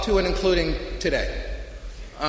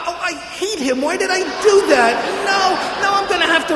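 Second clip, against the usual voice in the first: mock self-reproach; 12 semitones higher, 5 dB louder than usual. Mocking his own inner reaction.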